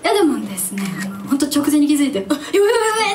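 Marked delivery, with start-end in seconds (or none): 2.3-3.1 high, loud mock panic; high-pitched hammed-up alarm. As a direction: yelp it like you just noticed the guitar. Retold panic on the train.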